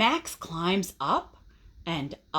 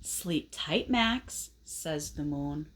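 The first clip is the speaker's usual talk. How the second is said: soft goodnight; 7 semitones lower, 6 dB quieter, slower than usual. A narrator's reading. Moon says goodnight softly.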